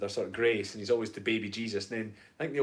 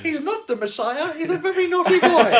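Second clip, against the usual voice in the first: very high, loud mock scolding; Life of Brian quote; 10 dB louder than usual. Quoting comedy film to co-host, shrieky mock scolding. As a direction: screech it like a comic mother. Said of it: "I'm gonna watch Life of Brian again".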